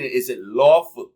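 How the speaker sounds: ordinary talk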